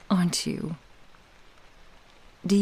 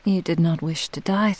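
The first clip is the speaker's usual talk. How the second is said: ordinary talk, nothing marked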